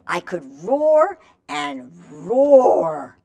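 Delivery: slow, drawn-out roaring read; slow lion voice for children. Acted. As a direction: growl it out like a lion. Lion page read to kids.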